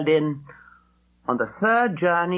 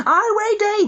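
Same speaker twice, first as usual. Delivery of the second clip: high excited scream of a name; children screaming; 5 dB louder than usual. A narrator's reading. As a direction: shout it high with delight. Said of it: "they screamed".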